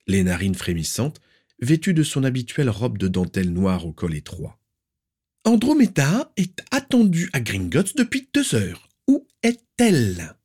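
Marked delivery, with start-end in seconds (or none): 5.5-10.4 put-on very high, sharp, demanding old aunt; shrill aunt. Aunt demanding where the niece is, shrill and stern. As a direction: pinch every word with disapproval.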